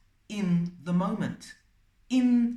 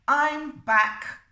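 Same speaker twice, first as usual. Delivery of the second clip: higher, louder self-command; emphatic self-reminder; 6 semitones higher, 6 dB louder than usual. Shown as a demonstration. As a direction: announce it firmly to pull yourself back. Demonstrates saying it to yourself.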